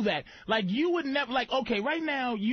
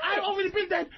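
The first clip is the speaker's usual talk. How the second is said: usual speaking voice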